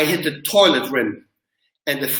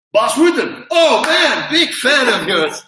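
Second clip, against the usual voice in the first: imitating an excited security guard, higher and louder; starstruck fan; 6 semitones higher, 4 dB louder, slower than usual. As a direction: burst out like a starstruck fan spotting his hero. Security guard recognizes famous fighter.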